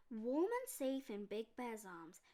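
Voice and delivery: plain reading voice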